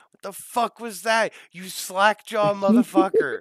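high, loud exasperated insult, drawn out at the end; mock outrage. Real, not acted. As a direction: blow up at a friend's pronunciation.

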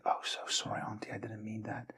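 whispered sorry, retold; hushed mock apology to an angry auntie; barely voiced, embarrassed sorry; sheepish apology. Apologizing to cursing neighbour.

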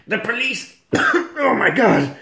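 high, shouty police chief impression; walrus chief voice. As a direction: bluster high like a pompous chief. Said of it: "Police Chief Walrus is going".

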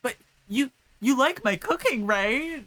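high, pleading, fishing for praise; insecure pleading. Retold or imitated. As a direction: beg for reassurance like a hurt cook. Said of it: "where it's like".

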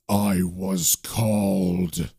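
very low, slow demon voice; deep monstrous reply, unhurried. Acted. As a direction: draw each word out deep and ominous. Demon answers the magician.

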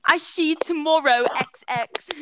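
exaggerated British sign-off; mock British accent. British person signing off a message, mock British accent.

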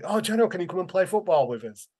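high, fast schoolkid plea; imitated eager kids. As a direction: ask eagerly like a child wanting a playmate. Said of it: "and they're like".

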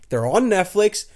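normal talking voice

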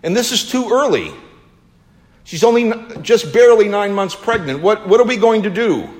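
scared and worried. Acted. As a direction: panic rising, pitched high. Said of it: "now she's scared and worried".

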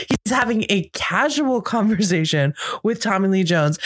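usual speaking voice

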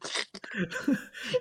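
a sudden high squeal of laughter. Real, not acted. Friend squeals with laughter recalling the scene. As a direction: burst into a high giggle.

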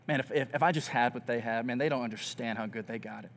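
1 bitter muttering. Retold or imitated. Envious inner grumble quoted.

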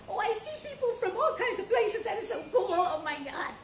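light up at the viewers; excited laughing exclamation; delighted surprise. Real, not acted.

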